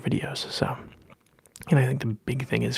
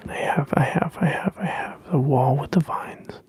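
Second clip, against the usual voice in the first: whispering; 3 semitones higher than usual. Acted. ASMR whisper repetition.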